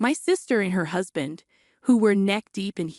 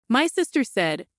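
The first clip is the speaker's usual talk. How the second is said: ordinary delivery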